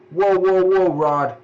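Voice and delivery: startled whoa. Acted. Ground shaking in game scene.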